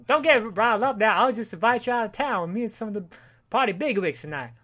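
put-on Southern accent. Gulch invites Howard out, in mock Southern accent. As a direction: play a hammed-up Southern guy. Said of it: "the Southern guy".

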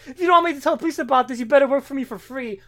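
high, loud, fast hammed-up threat; mock villain threat. Retelling the comic, gloating the villain's demand loudly. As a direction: gloat the blackmail like a cartoon villain.

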